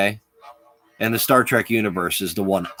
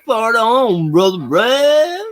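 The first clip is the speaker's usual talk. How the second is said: shouted greeting; 11 semitones higher, 6 dB louder, much slower than usual. Acted. Calling out greeting to friend.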